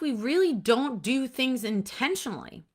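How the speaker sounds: ordinary delivery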